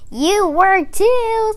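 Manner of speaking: high, slow taunting; teasing squirrel. Acted. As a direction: tease the raccoon. Squirrel teases raccoon.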